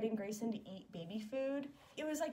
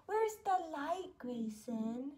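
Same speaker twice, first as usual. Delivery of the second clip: put-on baby talk question; much slower than usual. Asking baby to find light.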